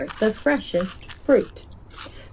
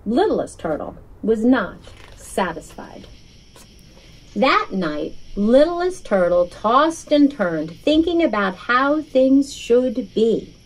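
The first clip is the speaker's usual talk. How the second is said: usual speaking voice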